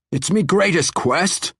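dwarf voice pitched high and loud in protest; a dwarf pleading on the edge of desperation. Acted. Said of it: "the dwarf protested".

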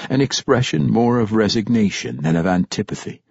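usual speaking voice

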